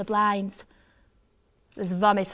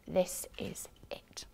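whispering; 3 semitones lower, 11 dB quieter, slower than usual. A narrator's reading. Hushed thrilled moment.

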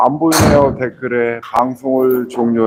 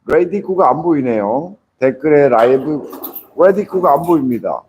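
normal talking voice

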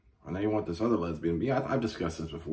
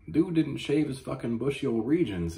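ordinary talk, nothing marked

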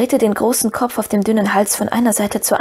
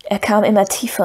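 ordinary delivery, nothing marked

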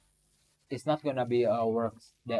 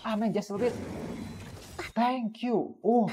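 excited; 11 semitones higher, 3 dB louder than usual. Real, not acted. Relieved thanks after big hit.

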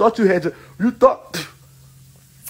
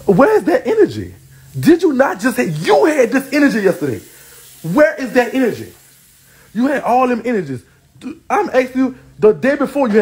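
high, loud taunting demand; taunting call-out, pitched up; 6 semitones higher, 3 dB louder than usual. Real, not acted. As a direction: jeer where's that energy at her rival. Taunting a rival live.

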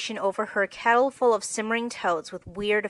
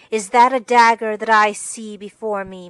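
hammed-up tragedian; 9 dB louder than usual. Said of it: "muttered Joe".

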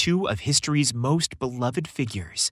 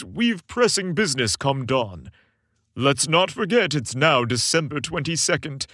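stern taskmaster; 3 semitones higher than usual. A narrator's reading. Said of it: "ever the taskmaster, cleared his throat".

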